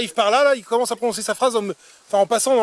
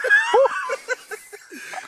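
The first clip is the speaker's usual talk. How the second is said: giggle. Real, not acted. Giggling on set.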